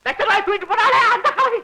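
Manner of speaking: high, agitated explanation; excited retort. Acted. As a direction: blurt it out high to set the son straight. Excited retort, then repeated low.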